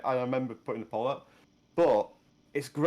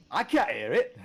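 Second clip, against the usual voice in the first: Welsh lilt, pitched higher; slightly Welsh accent; 6 semitones higher than usual. Real, not acted. Insisting they can't hear it, in a higher Welsh lilt. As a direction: protest in a rising Welsh twang. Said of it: "You just did it then".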